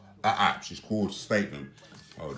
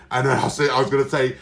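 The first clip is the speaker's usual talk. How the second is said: ordinary talk, nothing marked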